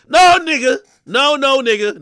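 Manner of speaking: loud, high flat no; loud rejection. Real, not acted. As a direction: shut it down loudly. Rejecting the soft-voice quote.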